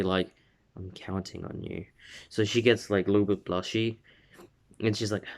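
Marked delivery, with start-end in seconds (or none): 0.8-1.9 very soft, confiding near-murmur; hushed earnest appeal. Retold or imitated. Deku entrusting Uraraka over the earpiece, hushed and earnest. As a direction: drop the volume right down, sincerely. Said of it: "like, very".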